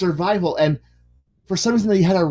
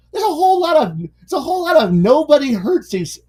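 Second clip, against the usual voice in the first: exasperated rising rant; 10 semitones higher, 4 dB louder than usual. Retold or imitated. Retold rant about medieval battle.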